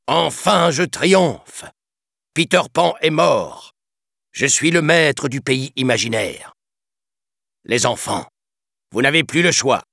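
gloating triumph. A narrator's reading. Captain gloating to the captive children, triumphant. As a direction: crow over the win like a tyrant. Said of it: "le sinistre capitaine exultait".

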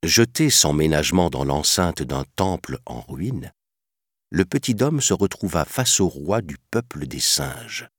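plain reading voice

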